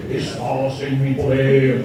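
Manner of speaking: slow, spooky mock hypnotist line. Teacher mocking a stage hypnotist's command, slow and hammed-up.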